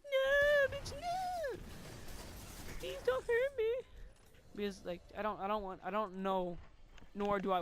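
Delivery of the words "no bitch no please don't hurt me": very high falsetto mock plea; imitating girls pleading. Imagined girls begging in his dungeon, shrill mock terror. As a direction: squeal it like a terrified captive, hammed up. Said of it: "he put 'em in the dungeon, and they'd be like".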